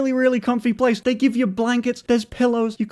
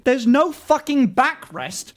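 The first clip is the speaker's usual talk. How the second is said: usual speaking voice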